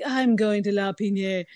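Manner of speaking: lightly voiced posh rich lady; a hint of a wealthy client. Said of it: "I like your rich lady voice".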